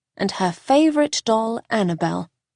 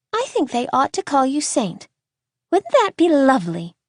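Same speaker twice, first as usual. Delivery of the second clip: put-on high child's voice, pleased; teasing little girl; 5 semitones higher than usual. A narrator's reading. Little girl proposes calling the boy Saint, high and pleased. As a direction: suggest it like a delighted child. Young Layla teases the boy.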